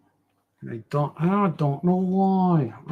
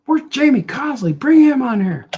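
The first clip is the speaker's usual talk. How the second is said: calling out; 10 semitones higher, 6 dB louder than usual. Pretend. Calling a guest onto the show.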